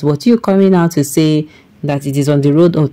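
ordinary talk

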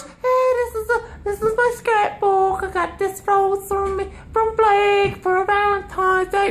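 put-on high, slow girly voice showing off a scrapbook; mocking girlfriend voice. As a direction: mimic her proudly showing her rose. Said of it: "show that random ass friend".

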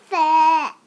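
high, loud cheer of sassafras; shouted. Acted. All the cousins shouting sassafras for the photo. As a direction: shout it at the camera all together. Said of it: "they all shouted".

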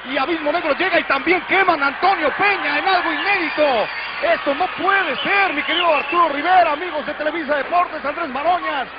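high, fast alarmed live report; excited reporting. Real, not acted. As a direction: report the chaos urgently. Reporter alarmed by backstage brawl.

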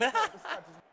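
high, loud burst of protest; frustrated yell. Real, not acted. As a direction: shout it in exasperation. Frustrated at his player.

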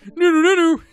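high, loud test hellos; trying out voices. Shown as a demonstration. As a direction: audition silly character greetings, loudly. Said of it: "what voice am I gonna give this one".

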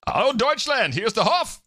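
high-pitched mock German hail; saludo alemán de broma. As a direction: play a German announcer hailing Deutschland. Hails the donor in Berlin in German.

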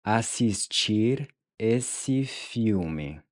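"Assistir esse filme" said slowly and clearly; syllable by syllable, for the student; slow model. Shown as a demonstration. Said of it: "Now say". ("Assistir esse filme", slow and carefully articulated)

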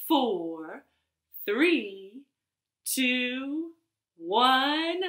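spaced count, each number rising; rocket countdown. Pretend. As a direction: build suspense towards lift-off.

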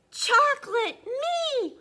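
high, loud, ecstatic shout; excited. Acted. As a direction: shout it with delight. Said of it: "saying a little more excited now".

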